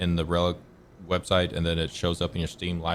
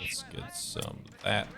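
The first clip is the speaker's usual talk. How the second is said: whispering "Let's get some of that" slow and soft; whispered; 3 semitones higher, 8 dB quieter than usual. Real, not acted. Gamer eyeing a target, whispering to himself. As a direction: breathe "Let's get some of that" under your breath. Muttered to himself while playing.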